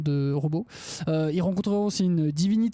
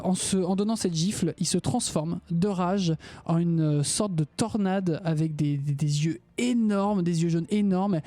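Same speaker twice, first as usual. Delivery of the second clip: ordinary talk, nothing marked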